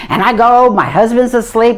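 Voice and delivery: ordinary talk, nothing marked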